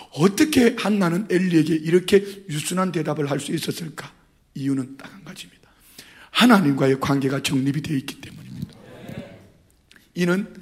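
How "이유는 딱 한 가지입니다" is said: hushed secret whisper. Real, not acted. Preacher whispers the key reason to the congregation. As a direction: lean in and whisper the one reason. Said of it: "sermon aside".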